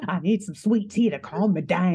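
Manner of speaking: a hammed-up Southern lawyer; fanning himself in a hot courtroom; Southern attorney. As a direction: play the overheated Southern attorney. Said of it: "an attorney in the heat of the South".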